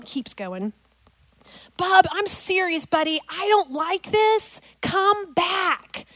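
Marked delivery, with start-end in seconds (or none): 1.8-6.1 high, insistent mom plea; retold anxious call, high and stretched out; urgent calling. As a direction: call him back firmly, pitched high. Mother pleading with straying son.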